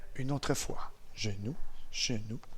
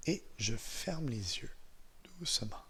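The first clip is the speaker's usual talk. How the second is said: guided visualization; 6 dB quieter than usual. Real, not acted. Instructor guiding eyes-closed memories of first snow, calm and unhurried. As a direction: ease them softly into a memory.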